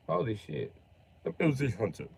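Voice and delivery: usual speaking voice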